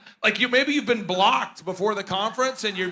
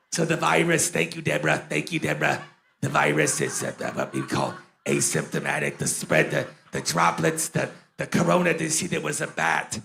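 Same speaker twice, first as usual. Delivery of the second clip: put-on much lower, mumbled old doctor voice; Fauci impression; 6 semitones lower than usual. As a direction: drop way down and mumble like a tired expert. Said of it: "And then Fauci".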